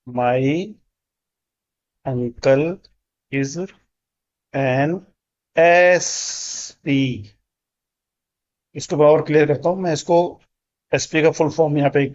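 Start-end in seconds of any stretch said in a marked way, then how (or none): 0.1-7.3 very slow, pausing after each word; word by word dictation. Shown as a demonstration. Teacher dictating the sentence to students, slowly. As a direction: drag out SP at the end. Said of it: "sentence बनेगा".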